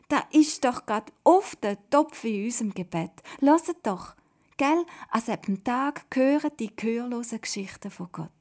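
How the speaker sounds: loud, high, excited pointing-out; calling out. A narrator's reading. Miriam reminding them of their prayer, excitedly calling. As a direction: exclaim it as a sudden discovery. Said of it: "ruft dort Miriam".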